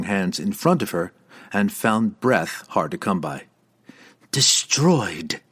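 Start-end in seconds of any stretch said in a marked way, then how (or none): 4.3-5.4 pained. Acted. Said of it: "suddenly obviously pained".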